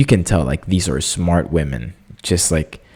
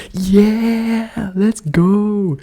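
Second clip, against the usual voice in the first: high cheer; much slower than usual. Retold or imitated.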